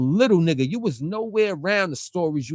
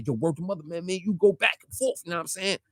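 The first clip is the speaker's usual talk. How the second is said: ordinary talk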